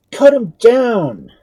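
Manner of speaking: high, slow furious yell from a soldier; cried out. A narrator's reading. Soldier to the doctor, slow high shouting. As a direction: bellow it at the prisoner. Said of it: "cried one".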